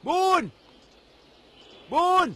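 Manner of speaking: high, drawn-out call for someone lost. Acted. Elder searches for missing Bun, calling out. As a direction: call far out for a missing person.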